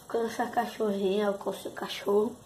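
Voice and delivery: ordinary talk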